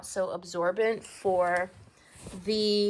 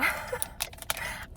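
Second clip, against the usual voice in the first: high breathless giggle; 11 semitones higher, 8 dB quieter than usual. Real, not acted. Unboxing, overwhelmed.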